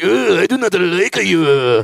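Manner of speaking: slow, lightly put-on mock Filipino accent; a half-hearted Filipino accent with a laugh. Mocking Filipinos on his fake show, in a mock Filipino accent. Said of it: "That's what they sound like".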